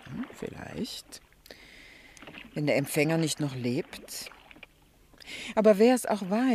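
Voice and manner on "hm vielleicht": slow, breathy whispered musing; whispered doubt. Acted. Mrs Prest doubts Joe's plan, slow whispered aside. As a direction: breathe it out, half to yourself.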